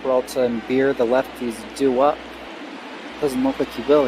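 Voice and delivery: ordinary talk, nothing marked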